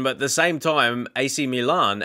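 ordinary talk

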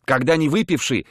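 ordinary delivery, nothing marked

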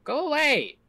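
high, loud yell of alarm; shouting. Real, not acted. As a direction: shout it at a wolf. Wolf attacking in game.

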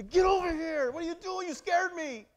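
put-on high, loud frightened shout at a child; scared parent shouting; panicked parent yell. Shown as a demonstration. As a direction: yell the kid back from the street. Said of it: "Or you say".

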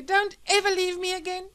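high, loud, clinging appeal; emotional plea. Acted. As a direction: beg her lovingly to stay. Husband's emotional reunion with wife.

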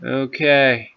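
ordinary talk, nothing marked

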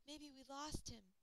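ordinary delivery

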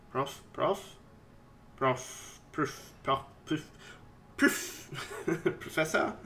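soft repeated hushed calls; calling softly. Pretend. Player softly calling the professor, hushed. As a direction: try to get his attention quietly.

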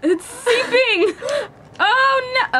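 high, loud alarmed squeal; shocked squeal. Real, not acted. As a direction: shriek as the can leaks. Can oozing as it opens.

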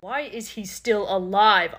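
normal talking voice

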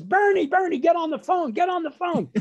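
high, urgent holler for a husband; screaming upstairs. Retold or imitated. Mother summoning father to the phone, screaming. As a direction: imitate a panicked mother calling through the house. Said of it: "she starts screaming upstairs".

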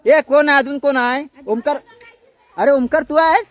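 loud, high questioning shout; calling out. Real, not acted. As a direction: call out to find who's there. Calling out to find who's there.

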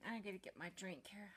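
hushed aside while reaching for a drink; quiet aside. Real, not acted. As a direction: drop the voice to a near whisper.

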